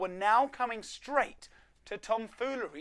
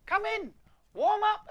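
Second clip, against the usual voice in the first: high, loud welcoming elf; friendly elf; 6 dB louder than usual. Acted. Elf welcoming frozen Tom inside, high cheerful call. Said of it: "a friendly elf advised".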